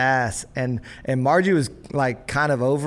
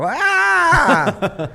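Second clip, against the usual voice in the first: put-on kid scream; 7 dB louder than usual. Kid screams from next room.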